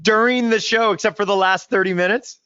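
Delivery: high-pitched, amused disbelief; incredulous surprise. Real, not acted. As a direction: react astonished, voice shooting way up. Co-host astonished guest slept through show.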